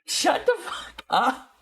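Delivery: high, slow, laughing outburst; laughing disbelief. Real, not acted. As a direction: drag it out through real laughter. Laughing on getting the joke.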